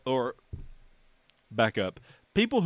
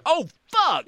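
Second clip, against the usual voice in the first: surprised; 5 dB louder than usual. Retold or imitated. Retelling reaction to teaser.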